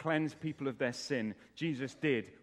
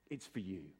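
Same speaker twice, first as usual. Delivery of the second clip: lower, much softer assurance; quiet reassurance; 6 semitones lower, 9 dB quieter than usual. Acted. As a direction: let it sink in gently. Same assurance repeated softly.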